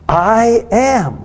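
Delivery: I am said high and apart; emphatic I am. Acted. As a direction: mark I am as the key words. Repeating Jesus' words I am.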